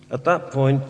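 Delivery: ordinary delivery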